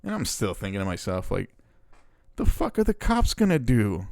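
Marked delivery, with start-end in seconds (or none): 2.3-4.1 high-pitched incredulous question; scoffing disbelief. Acted. Viewer reacting to threat to call the cops, scoffing.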